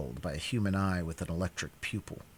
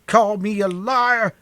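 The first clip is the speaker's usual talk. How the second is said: slow, high, loud wail; 12 semitones higher, 9 dB louder, slower than usual. Acted. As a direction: drag it out in an aggrieved wail. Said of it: "the other wailed".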